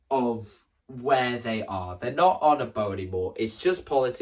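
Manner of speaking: ordinary delivery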